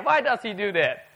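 ordinary talk

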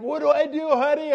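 high, frantic mock plea; comic high-pitched panic; panicked husband. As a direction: cry out helplessly to your wife.

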